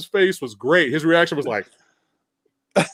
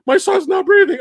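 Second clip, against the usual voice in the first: panicked parent quote; 10 semitones higher than usual. Retold or imitated. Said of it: "they go from that".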